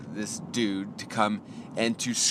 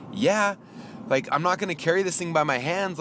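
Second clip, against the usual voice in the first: retold obvious-answer protest, pitched up; exasperated retort; 8 semitones higher than usual. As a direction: answer as if it's painfully obvious. Retold retort about needing trailer.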